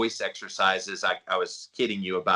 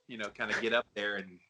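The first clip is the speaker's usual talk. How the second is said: ordinary talk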